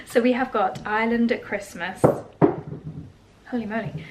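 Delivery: ordinary talk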